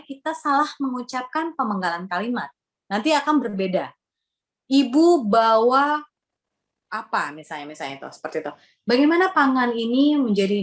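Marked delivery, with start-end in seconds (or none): 4.7-6.0 IBU BAWA slowly, each word set apart; slow, carefully divided "Ibu bawa"; deliberate phrasing. Shown as a demonstration. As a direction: mark the break between "Ibu" and "bawa". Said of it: "Kemudian pemenggalan kalimat".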